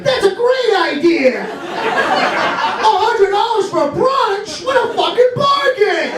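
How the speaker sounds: very high, hammed-up delight; mock enthusiasm. Mocks lovestruck spender.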